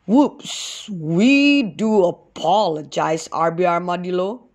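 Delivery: lightly voiced apologetic dog; a hint of a sheepish dog, slow; watchdog voice. A narrator's reading. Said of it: "the watchdog said".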